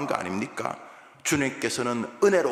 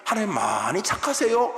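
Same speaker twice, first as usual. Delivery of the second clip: sarcastic; 6 semitones higher than usual. Retold or imitated. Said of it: "이렇게 비아냥입니다".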